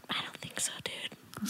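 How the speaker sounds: unvoiced whisper of the teacher; whispered verdict. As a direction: breathe the bad news. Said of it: "he was like".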